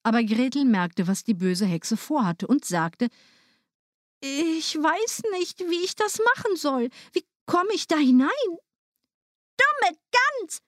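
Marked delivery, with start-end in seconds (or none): 4.2-8.3 put-on clueless little girl. A narrator's reading. Gretel tricking the witch at the oven, innocent. 9.6-10.6 very high, scolding witch voice; scornful old witch. A narrator's reading. Witch annoyed at Gretel's question, scornful. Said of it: "sagte die Alte".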